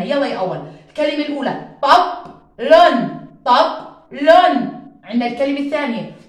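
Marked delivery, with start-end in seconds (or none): 0.9-4.8 segment طب لون syllable by syllable loudly; syllable segmentation. Shown as a demonstration. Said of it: "نقطع صوتي زي ما تعلمنا". (تقطيع صوتي بصوت عالٍ)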